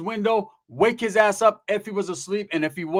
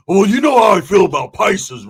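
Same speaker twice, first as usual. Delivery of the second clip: loud acted objection; indignant outburst; 3 semitones higher, 10 dB louder than usual. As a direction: shout it in mock outrage. Roach objecting in acted exchange.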